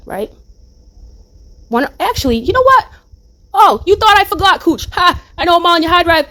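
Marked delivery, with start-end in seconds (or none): 2.4-6.3 high, loud gloating burst; gleeful gotcha. Real, not acted. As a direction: crow over catching her out, much higher and louder. Triumphant outburst at Cooch.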